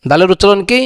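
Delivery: normal talking voice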